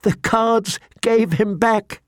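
slow, high, tearful relief; through tears. A narrator's reading. Jon tells the Lord Commander the news, tearful. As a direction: draw it out high, near crying. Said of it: "through the tears".

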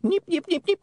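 very high squeaky nipping; recording stuttering; high imp glitch chatter; imp skipping noise. A narrator's reading.

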